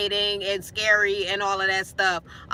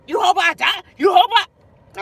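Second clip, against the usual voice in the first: mock outraged shout; 6 semitones higher, 8 dB louder than usual. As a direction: shout it back in disbelief. Roommate's outraged reply.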